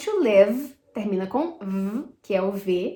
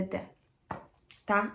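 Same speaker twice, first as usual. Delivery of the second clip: usual speaking voice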